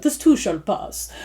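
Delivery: soft, slow, botched British accent; attempted British accent. Shown as a demonstration. Reader jokes with listeners, badly attempting a British accent. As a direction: try a British accent and miss it slightly. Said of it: "with a British accent".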